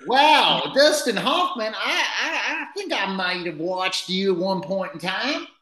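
high put-on host character; fake character voice. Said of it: "a fake character".

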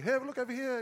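playful distraction. Pretend. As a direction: call their attention away in a mock diversion. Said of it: "I'm just gonna distract them".

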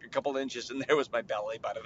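ordinary delivery

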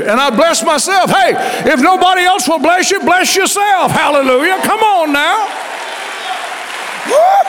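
high, loud preaching with laughter; full-force preaching. Acted. Preacher urging the church to bless themselves, loud preaching. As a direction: rouse the congregation, shouting the blessing.

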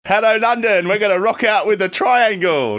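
high, loud Cockney rock-star greeting; cheeky Cockney. Acted. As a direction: shout to the crowd like a Cockney rocker. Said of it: "your cheeky Cockney accent".

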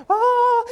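a sudden, very high mock cry of the beast; mock roar. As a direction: yelp it out sky-high for comic drama. Mimicking the beast's transformation.

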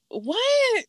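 high, drawn-out disbelieving what; a shocked mock reaction; stunned what. Reacting to his claim.

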